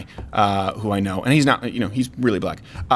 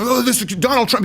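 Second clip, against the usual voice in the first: high, mock-ranting quote; going nuts; 9 semitones higher than usual. Chicago guy ranting about Trump, going nuts. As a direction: imitate a man losing it, pitched up. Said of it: "he starts going nuts".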